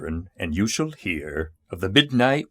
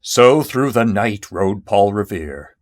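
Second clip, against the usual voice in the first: usual speaking voice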